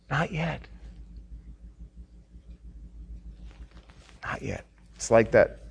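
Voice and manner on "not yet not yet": fading to a near whisper; hushed not yet. Acted. Preacher echoing God's gentle refusal, hushed. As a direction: let the words die away softly.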